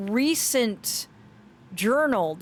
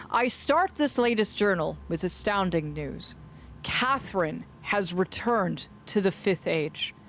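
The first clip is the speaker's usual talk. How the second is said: measured, lower storytelling voice; dramatic journal narration; 4 semitones lower, 3 dB quieter than usual. Acted. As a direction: read the journal as its brooding writer, with deliberate pauses. Reads journal aloud in storytelling voice.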